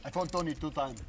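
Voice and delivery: lightly put-on Russian accent; a hint of a Russian man. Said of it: "That's a Russian accent".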